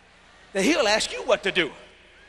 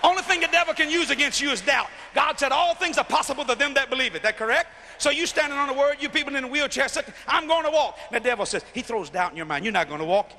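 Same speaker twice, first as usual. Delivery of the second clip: full-force preaching; 6 semitones higher than usual. Acted. Preacher to the arena, preaching at full force. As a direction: preach it hard to the crowd.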